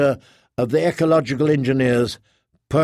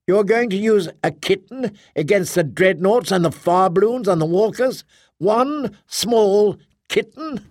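much higher, incredulous and rising; a Guardian who cannot believe it, pitched up; Kay's voice; 7 semitones higher than usual. A narrator's reading. Kay incredulous.

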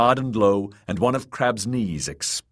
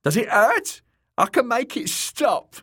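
high, taunting fool voice; high-pitched sadistic clown; mocking jester; 4 dB louder than usual. A narrator's reading. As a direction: feign concern with cruel glee. Jester taunts the wounded man.

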